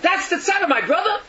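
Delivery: very high, loud, horrified cry; shocked outcry. Acted. Sister hears of her brother's suffering, shocked outcry. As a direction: cry out at terrible news, pitch leaping up.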